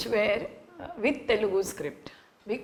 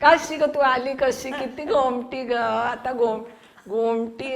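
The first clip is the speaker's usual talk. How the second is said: put-on fast, high Marathi with a Tamil accent; 4 semitones higher, 8 dB louder, much faster than usual. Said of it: "in typical Tamil accent".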